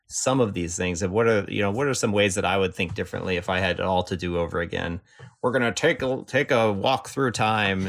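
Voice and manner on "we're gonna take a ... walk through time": put-on old man voice. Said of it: "was my old man voice".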